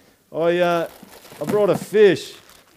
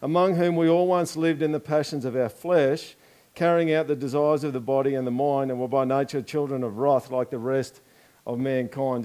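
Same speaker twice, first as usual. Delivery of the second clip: ordinary talk, nothing marked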